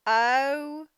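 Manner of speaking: drawn-out pitying oh; sympathetic oh. Acted. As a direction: sigh it out for poor Stan. Reading a story to children.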